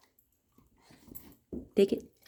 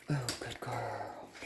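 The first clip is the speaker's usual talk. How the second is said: soft praise; 5 semitones lower, 13 dB quieter, much slower than usual. Real, not acted.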